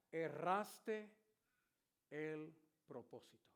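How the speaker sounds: a hint of a solemn divine voice; God answering the praying preacher. Voice of God speaking to him.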